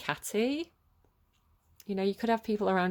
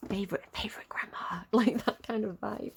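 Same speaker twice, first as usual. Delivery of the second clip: whispered aside. Real, not acted. Fond aside about the card.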